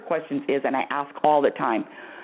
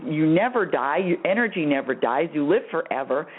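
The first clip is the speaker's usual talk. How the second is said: ordinary talk, nothing marked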